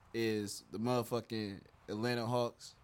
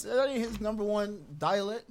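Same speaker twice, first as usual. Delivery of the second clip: ordinary talk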